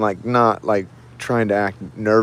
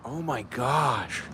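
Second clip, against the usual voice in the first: stunned hush; 10 dB quieter, much slower than usual. Real, not acted. Stunned after seeing violence.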